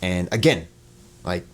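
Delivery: usual speaking voice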